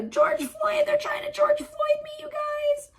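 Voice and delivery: very high mock wail; shrieking victim voice. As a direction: shriek it out dramatically. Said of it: "She'd be".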